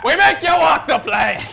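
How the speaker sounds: loud pirate bellow; pirate shout. Pretend. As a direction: roar it like a pirate captain.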